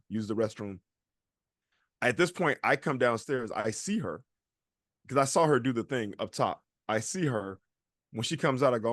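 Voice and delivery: ordinary talk, nothing marked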